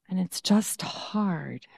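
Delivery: soft, slow, whispered; gentle whisper of empathy; whispered sympathy. Real, not acted. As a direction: hush it sympathetically. Empathising with struggle.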